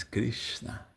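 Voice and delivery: breathed out as a whisper; reverent whisper. Real, not acted. Reverent naming of the holy syllable.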